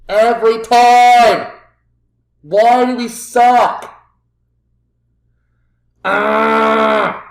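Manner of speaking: loud, high, slow furious outburst; frustrated yell. Real, not acted. Manager shouting at his team after conceding. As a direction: yell it out at your useless team.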